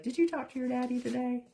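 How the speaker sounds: high, quick mimic of her husband; teasing husband. Husband teasing wife about her Appalachian phone voice. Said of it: "Ron is always like".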